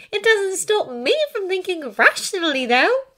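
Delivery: high, loud, reasoning protest; arguing. A narrator's reading. Hermione telling Draco to be rational, insistent. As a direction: argue the point in a high voice. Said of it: "Hermione argued".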